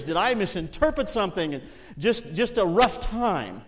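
loud, high pleading to the Lord; fervent confused plea; impassioned preaching. Acted. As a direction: pour out confusion like a prayer. Retelling despair to the Lord.

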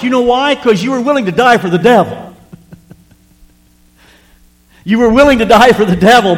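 loud, high fired-up preaching. Acted. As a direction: preach it out with fire to the church. Preaching at full force to congregation.

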